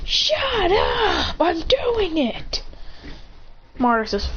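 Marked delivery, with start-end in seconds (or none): 0.0-2.6 high, drawn-out mock yell; exasperated yell. Naruto snapping back at the others, exasperated yell. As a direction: stretch it out high, fed up.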